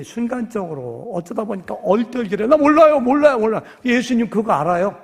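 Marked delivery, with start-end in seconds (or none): 2.5-3.6 loud, much higher frantic denial; panicked denial. Acted. Peter panicking as he denies knowing Jesus. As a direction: blurt it out, scared.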